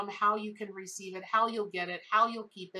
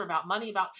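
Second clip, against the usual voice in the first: ordinary talk, nothing marked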